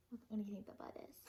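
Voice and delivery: fast unvoiced whisper. Real, not acted. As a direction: tease the listeners in a hush. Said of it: "reader asks listeners".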